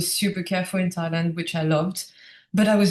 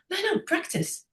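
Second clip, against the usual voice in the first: much higher and softer, sing-song no-no; imitating the monk; 11 semitones higher, 6 dB quieter than usual. Monk patiently repeating to the stubborn guest, high and soft. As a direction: lightly brush it off in a high, quiet voice.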